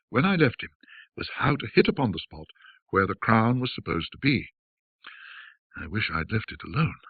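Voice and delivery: ordinary delivery, nothing marked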